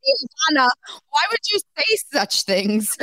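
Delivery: high, loud teasing scold through laughter; laughing mock scolding. Real, not acted. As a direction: scold her while cracking up.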